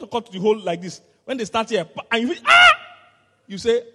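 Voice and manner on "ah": pained cry. Retold or imitated. Feels the first cut.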